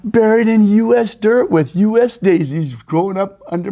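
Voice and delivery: loud, high, rousing declaration; mock-patriotic fervor. Gambler imagines being buried in U S dirt, mock-patriotic. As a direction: proclaim it with hammed-up patriotic zeal.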